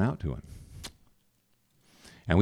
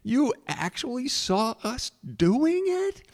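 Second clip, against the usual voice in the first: very high, tickled disbelief; imitating a friend cracking up; laughing out loud; 4 dB louder, slower than usual. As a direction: squeal it slowly with amusement. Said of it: "Darlene just laughed out loud".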